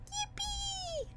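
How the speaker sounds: high, soft squeaky yippee; squeaky cheer. Real, not acted. Vtuber squeaking yippee at the chat. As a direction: cheer like a cartoon.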